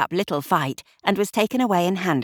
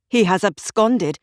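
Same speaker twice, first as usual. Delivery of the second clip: a mild narrated shout, only a little louder; 3 semitones higher, 4 dB louder than usual. A narrator's reading. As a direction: call it out sharply, understated. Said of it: "he shouted".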